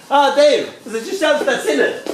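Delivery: high, exclaimed hail to a friend; hearty Irish greeting. Acted. Irishman greeting Dave, high hearty greeting. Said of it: "But when we Irish say".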